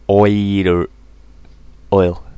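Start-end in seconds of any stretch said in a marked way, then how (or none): none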